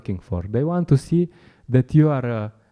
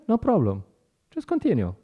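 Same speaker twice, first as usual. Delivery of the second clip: usual speaking voice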